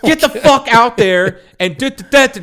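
high, loud imitation of a mother shouting; yelling mom. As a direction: holler like an angry mom calling her son. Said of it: "she's yelling at him".

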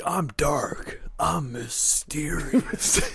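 lightly voiced brooding tough guy; brooding mock villain. Host mocking the wrestler's brooding entrance, lightly voiced. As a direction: play at being dark and mysterious.

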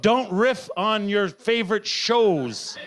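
slow, deliberate reading of the note; reading my own note. Shown as a demonstration. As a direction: read the note out slowly, word by word. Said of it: "I've even given myself a note".

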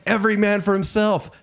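high yelled cry; mock yell. Brother yelling while pushing him from the plane, mock yell. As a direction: shout it like you are falling from a plane.

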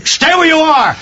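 high, loud order; commanding shout. Acted. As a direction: call out sharply after him. Inspector calls after fleeing man.

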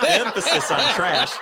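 laughing through the words. Real, not acted. Laughing while mocking the division. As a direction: cracks up mid-jab, voice up high. Repeating the joke.

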